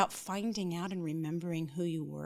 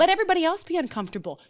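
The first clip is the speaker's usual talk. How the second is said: louder and higher, fast and forceful; emphatic outburst; 9 semitones higher, 8 dB louder, much faster than usual. Real, not acted. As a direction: push it out fast, with conviction. Emphatic outburst about others' comfort.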